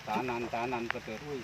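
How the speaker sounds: ordinary talk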